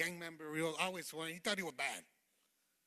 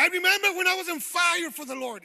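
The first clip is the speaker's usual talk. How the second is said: much higher, louder acted outburst; impassioned cry; 11 semitones higher, 15 dB louder than usual. Acting a believer remembering zeal for the congregation, impassioned cry. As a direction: cry it out high and loud.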